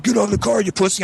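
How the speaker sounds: high-pitched imitation of an angry challenger; confrontational. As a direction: retell a taunting demand. Imitates brother confronting him.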